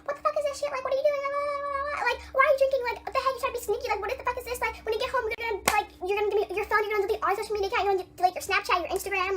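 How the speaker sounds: high-pitched mock yelling, racing along; imitated angry texts. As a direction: mimic a ranting mom way up high. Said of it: "yelling in all caps, saying".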